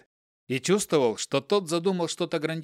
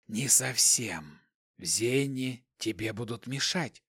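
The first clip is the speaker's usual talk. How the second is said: quiet, slow, emotionless hiss; 4 semitones lower, 5 dB quieter, slower than usual. A narrator's reading. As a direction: hiss it slowly and softly. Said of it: "Шипящий голос не выражал никаких эмоций".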